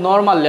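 normally with R sounded, "nor-mal-li"; full three-syllable "normalli" for normally; local pronunciation. Shown as a demonstration. Teacher quoting local usage, "nor-mal-li". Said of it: "আমরা বলি".

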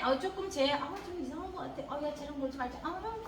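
put-on hushed, sneering gossip between kids; a kid quietly talking behind a friend's back; snide whispering. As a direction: mutter it like a child excluding a friend. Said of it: "막 이러면서 업신여겨".

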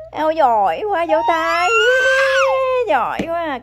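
loud, high cheering "good job"; excited praise. Real, not acted. Praising toddler after words.

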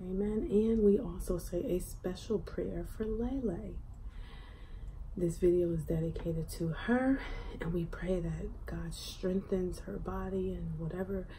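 soft, hushed grace before eating; quiet prayer. Real, not acted. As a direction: pray softly over the food. Saying grace quietly at the table.

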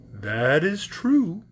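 drawn-out, unhurried straw man; slow scarecrow voice. A narrator's reading. Scarecrow answering Dorothy, slow and drawn out. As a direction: stretch every word like a lazy scarecrow.

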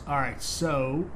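ordinary talk

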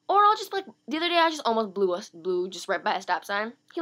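normal talking voice

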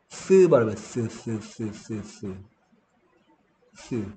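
쓰 said as th, tongue between teeth; 쓰, breathy unvoiced th hiss; th sound. Shown as a demonstration. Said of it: "바람 부는 소리라고 했습니다". (혀를 물고 바람만 부는 쓰 소리)

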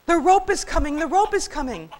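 high, loud panicked warning; loud, high-pitched alarm; frantic alarm cry. Acted. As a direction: cry out the alarm to the whole barnyard. Frantic birds sound the alarm.

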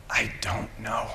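slow, near-whispered answer; hushed admission. Acted. Fire captain answering his friend under blazing towers, hushed. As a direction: say it slowly, almost without voice.

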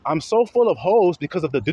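ordinary talk, nothing marked

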